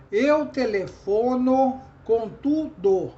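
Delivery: slow, loud, evenly measured through "tudo"; counting every syllable. Shown as a demonstration. Teacher counting common syllables of a line, syllable by syllable. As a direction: chant out each syllable, finishing the "do" of "tudo". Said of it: "Se você for contar as sílabas comuns".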